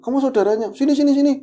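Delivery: high, loud urgent call to come over. Retold or imitated. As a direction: wave someone over in a hurry. Pembina urgently calling the narrator over. (memanggil dengan keras dan tergesa)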